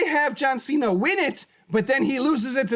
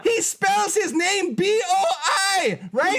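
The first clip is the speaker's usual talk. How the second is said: wrestling promo; 4 semitones higher than usual. Acted. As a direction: rant at the rival like a wrestler on the mic. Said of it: "I had to go on a little bit of a rant there".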